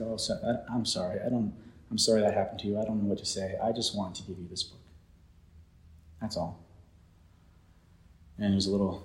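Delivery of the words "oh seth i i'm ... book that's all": softer, lower voice of an apologetic friend; subdued retold apology. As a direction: quote a friend's quiet, halting apology. Friend's gentle apology after an outburst.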